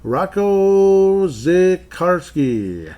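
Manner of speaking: slow, high, stretched announcer call; drawn-out name call. Pretend. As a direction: savor the name like a ring announcer.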